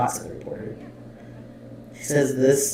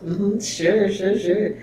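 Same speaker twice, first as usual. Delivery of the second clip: high mock "sure, sure"; hammed-up skeptical agreeing; imitated doubtful agreement; 6 semitones higher, much slower than usual. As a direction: humour someone without believing them. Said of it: "The way that her file looks like".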